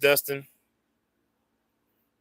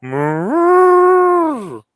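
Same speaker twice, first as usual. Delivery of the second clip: high, loud, drawn-out moo; loud cow moo; 16 dB louder than usual. Shown as a demonstration. Owner showing the dog a louder moo, high and loud. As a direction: belt out a big moo. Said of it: "can you go".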